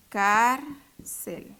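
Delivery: "Cárcel" as "Cár ... cel"; syllable by syllable. Shown as a demonstration. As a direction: separate "Cárcel" with a clear pause. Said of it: "dividámosla". ("Cár ... cel", separado en sílabas)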